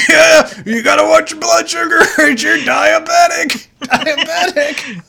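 high, hammed-up shouting through laughter; mock yell. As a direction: joke shouting, cracking up. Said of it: "they should've just yelled".

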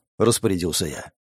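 usual speaking voice

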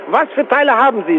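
ordinary delivery

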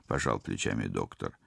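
usual speaking voice

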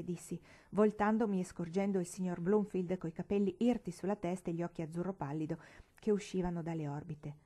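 ordinary delivery

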